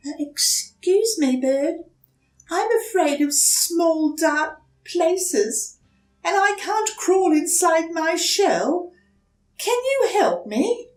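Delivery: put-on high, hesitant small-child voice; young turtle politely asking a stranger for help; timid little turtle. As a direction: ask shyly, a little scared. Franklin asks the bird.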